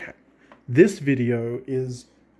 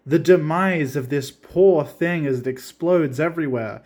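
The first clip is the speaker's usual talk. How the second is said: usual speaking voice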